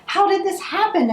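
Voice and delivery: high, startled cry of disbelief; shocked cry. Acted. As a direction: gasp it out as if caught off guard. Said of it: "everyone cried".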